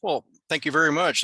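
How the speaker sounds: usual speaking voice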